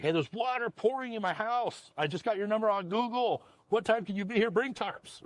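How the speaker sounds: high-pitched mock-panicked caller; urgent customer. Homeowner phoning roofer about pouring water, urgent. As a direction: imitate a homeowner calling for emergency help. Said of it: "that are screaming at you".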